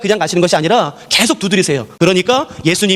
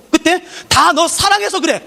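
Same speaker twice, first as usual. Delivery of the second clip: higher, slow, self-justifying parent; parent's excuse; 8 semitones higher, slower than usual. Acted. Parent tells the child it was all out of love, higher and slow. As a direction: drag it out like a parent defending themselves. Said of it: "부모님들이 꼭 하는 말이 뭔지 아세요".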